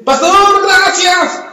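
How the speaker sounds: a high, lightly voiced thankful friend; grateful brother. Pastor quotes the grateful brother thanking him, lightly voiced high.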